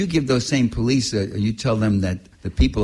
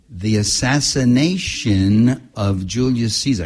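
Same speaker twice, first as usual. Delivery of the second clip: slow, drawn-out title, louder, every word weighted; dwelling on it; 5 dB louder, slower than usual. Retold or imitated. Writer tells the customs officer his book title, slow and loud. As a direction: savour each word of the title. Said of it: "I really dwelled".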